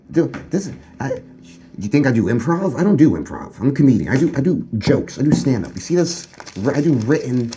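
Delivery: loud offended comedian defending his craft; indignant rant. Pretend. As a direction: bristle at the insult, defending yourself. Indignant rant about improv.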